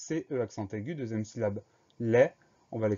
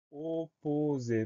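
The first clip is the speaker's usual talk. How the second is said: slow, higher, each vowel held long; drawn-out dictation; 4 semitones higher, much slower than usual. Shown as a demonstration. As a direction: stretch the word syllable by syllable. Dictée, mot annoncé lentement. (mot dicté très lentement, syllabes étirées)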